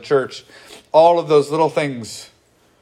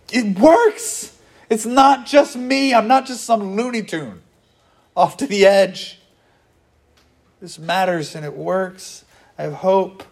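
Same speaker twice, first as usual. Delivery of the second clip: much higher, eager and amazed; hopeful church voice; 7 semitones higher than usual. Retold or imitated. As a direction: sound like someone who just found out it works. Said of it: "we go".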